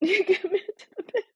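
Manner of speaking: high words dissolving into laughter; real giggles breaking up the words; laughing to tears. Real, not acted. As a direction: try to say it, then crack up. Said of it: "I'm really crying".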